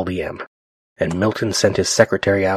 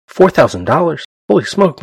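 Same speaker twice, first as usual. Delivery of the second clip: shocked outburst; 9 semitones higher, 6 dB louder than usual. Acted. Banker Prue aghast at a loan.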